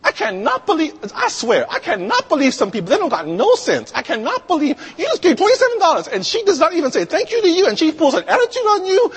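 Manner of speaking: very high, fast indignant rant; hammed-up outrage, pitch soaring; cashier beside herself. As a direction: rant in squealing disbelief. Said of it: "the cashier is beside herself".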